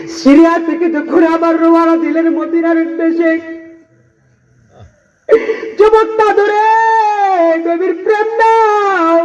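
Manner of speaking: high, impassioned sermon voice; fervent waz preacher pleading; preaching at full force. Acted. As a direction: plead as Abu Bakr from the pulpit.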